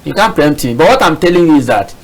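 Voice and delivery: usual speaking voice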